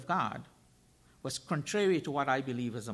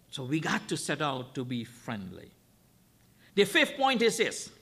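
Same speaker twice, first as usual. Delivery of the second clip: usual speaking voice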